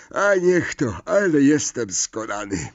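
exhausted, voiced through a big yawn. A narrator's reading. Tired son after breakfast, yawning as he speaks. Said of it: "ziewnął Fred".

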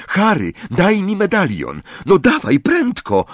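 high, urgent demand; impatient. A narrator's reading. Hermione wants the locket from Harry, impatient. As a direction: hurry them along, pitch climbing. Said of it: "dodała niecierpliwie".

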